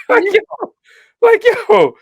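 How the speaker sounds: high-pitched, amused disbelief; amazed. Retold or imitated. Telling co-host about spotting another kid's stopwatch, high amazed squeal. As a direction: squeal it out, tickled and astonished. Retelling his astonishment at the kid.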